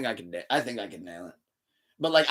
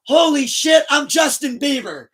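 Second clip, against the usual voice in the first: high, loud, slow stunned shout; seeing the plaques in the hallway, stunned shout; shocked realization; 12 semitones higher, 11 dB louder than usual. Retold or imitated. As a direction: shout it in disbelief. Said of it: "I'm like".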